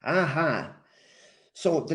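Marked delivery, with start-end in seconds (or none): none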